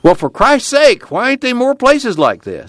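very high-pitched, incredulous question; amazed exclamation. A narrator's reading. Newcomer asking watchman about the camp, amazed.

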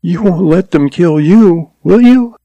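high-pitched worried pleading; frightened girl's voice rising at the end; anxious young woman. A narrator's reading. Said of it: "Frayne felt it quiver against his arm".

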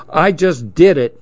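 usual speaking voice

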